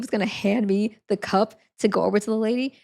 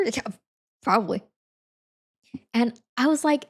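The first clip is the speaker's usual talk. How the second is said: ordinary delivery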